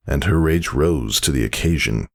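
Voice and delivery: plain reading voice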